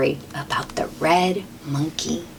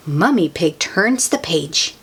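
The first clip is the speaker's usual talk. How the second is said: usual speaking voice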